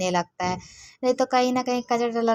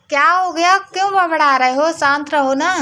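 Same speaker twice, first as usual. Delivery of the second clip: sharp scolding shout, much higher and louder; real angry yelling; 7 semitones higher, 6 dB louder than usual. Real, not acted. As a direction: yell at him to stop muttering. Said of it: "मुझे खुद भी अच्छा नहीं लगता किसी को चिल्लाना".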